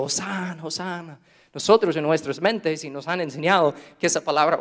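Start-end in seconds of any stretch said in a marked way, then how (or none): 0.0-1.2 soft hosana. Retold or imitated.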